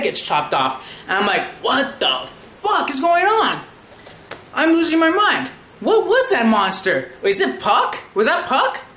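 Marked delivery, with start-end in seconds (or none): 1.6-8.8 high-pitched, incredulous reenacted reaction; bewildered outburst. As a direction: act out losing your mind in disbelief. Acts out own baffled reaction.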